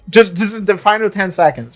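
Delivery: ordinary delivery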